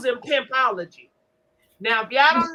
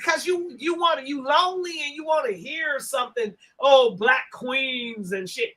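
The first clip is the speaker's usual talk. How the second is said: normal talking voice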